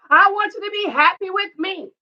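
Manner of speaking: high-pitched retold demand for happiness; mock pleading wife. Repeats the wife's plea.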